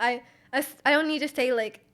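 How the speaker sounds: ordinary talk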